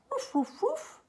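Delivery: high playful barking; high yappy woofs; puppy barks. Acted. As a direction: bark like a little dog. Dog barks in story.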